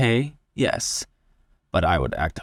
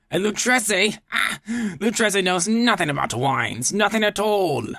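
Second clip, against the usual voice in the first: put-on higher, boastful drunk insisting; tipsy braggart, higher and pushy; drunken Fortunato; 7 semitones higher than usual. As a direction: brush off every warning, giddy with wine.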